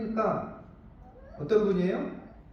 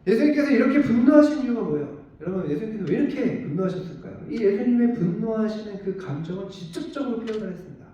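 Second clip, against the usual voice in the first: ordinary talk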